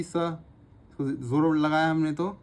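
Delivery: slow, with ज़ोर stressed; slow down to show the heavier sound; slow stressed explanation. Shown as a demonstration. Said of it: "एस light sound थी".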